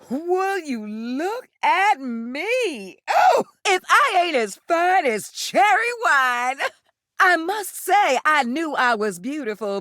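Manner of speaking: high, slow, savoring each word; giddy delight. Acted. Newly real, she admires herself, giddy and high. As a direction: marvel at yourself like a fresh bloom.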